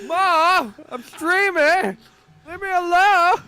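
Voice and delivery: very high, loud mock tantrum at mom; hammed-up yelling. Streamer to interrupting mother, hammed-up high shouting. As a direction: holler it like an exasperated kid streamer.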